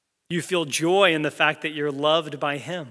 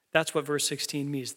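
ordinary talk, nothing marked

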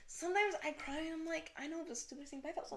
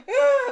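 mock wail; 13 semitones higher, 16 dB louder than usual. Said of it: "you're going".

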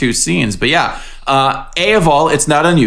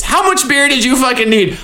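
incredulous; 10 semitones higher than usual. Real, not acted. Incredulous about the beer.

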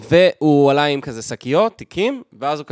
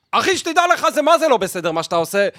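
higher, pushed imitation of a man yelling at a stranger; mock Mizrahi shouting; 10 semitones higher than usual. Telling the story of a man scolding a passenger, imitating his high mock yell. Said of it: "אני מנסה ל-, לצעוק כמו מזרחי".

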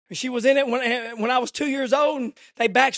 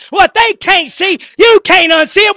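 high, loud cry of the line, building to a shout; preacher's shouted repeat; shouted refrain; 8 semitones higher, 15 dB louder than usual. Shown as a demonstration. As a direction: hammer the refrain home. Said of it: "Did you let that sink in?".

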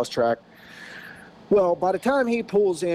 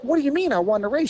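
high, quick confused question; baffled question; 9 semitones higher, faster than usual. Retold or imitated. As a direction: rush it out in confusion. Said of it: "So I'm like".

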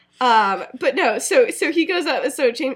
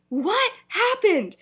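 high, drawn-out "what happened?"; shocked question; 9 semitones higher, much slower than usual. Acted. Somebody across the room catching on, shocked. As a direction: stretch it out slowly, pitched way up.

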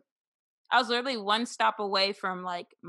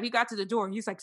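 normal talking voice

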